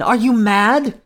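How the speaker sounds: high, loud rising question; incredulous cry. A narrator's reading. Queen doubting the king's sanity, high incredulous cry. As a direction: challenge him, high and incredulous. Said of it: "cried the queen".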